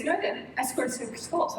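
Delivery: put-on teen son insisting. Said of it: "He goes".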